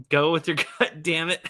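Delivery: ordinary talk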